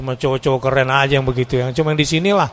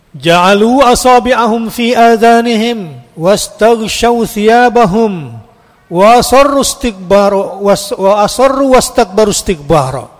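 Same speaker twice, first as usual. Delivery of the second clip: slow, higher, louder chanted recitation of the verse; Quran recitation; 7 semitones higher, 7 dB louder, slower than usual. Acted. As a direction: draw out each Arabic word in a chant.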